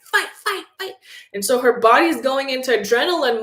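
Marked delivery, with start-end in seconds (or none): 0.0-0.9 a much higher chant of fight; urging body. Retold or imitated. Her adrenaline-pumped body urging her to fight, mock high chant. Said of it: "the physiology was pumping, like".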